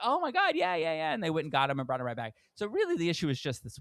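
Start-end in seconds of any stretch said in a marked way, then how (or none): 0.0-1.1 much higher, eager staff voice; eager yes. Retold or imitated. Staff rushing to fix the plates, much higher eager gush. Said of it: "they were like".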